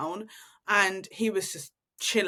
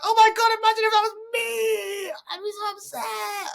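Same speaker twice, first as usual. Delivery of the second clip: mock panic; 12 semitones higher than usual. Shopper imagining herself in the stranger's place, high mock panic. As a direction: squeal it like imagined embarrassment is unbearable. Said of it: "but I was like".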